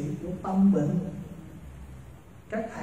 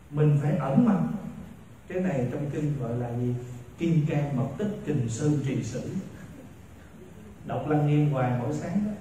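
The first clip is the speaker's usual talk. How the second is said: ordinary talk, nothing marked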